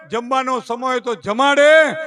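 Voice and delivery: normal talking voice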